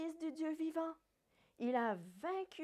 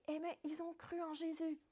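normal talking voice

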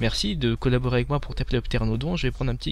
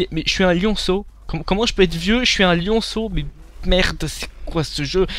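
indignant disbelief; 5 semitones higher than usual. Real, not acted. Player told his lion cub is old, indignant disbelief. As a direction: protest like the game cheated you.

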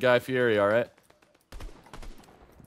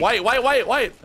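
urgent callout; 11 dB louder, slower than usual. Real, not acted. Calling out enemy.

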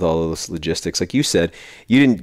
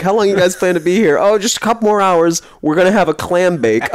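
high, raised-pitch chirpy quoting; much higher mock-cheery voice; imitated visitor exchange; 10 semitones higher, 4 dB louder than usual. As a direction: play it up as oblivious picnickers. Acts exchange with cheerful intruders.

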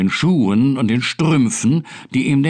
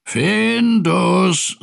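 drawn-out searching call, pitched up; long, raised call; 6 semitones higher than usual. A narrator's reading. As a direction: call for a lost pet. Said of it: "rief Pettersson".

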